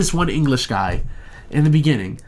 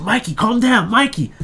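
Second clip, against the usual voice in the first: high, mock soothing voice; hammed-up plea to calm down; calming Mikey; 9 semitones higher than usual. Retelling the movie, a lightly voiced plea to Mikey. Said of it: "He's like".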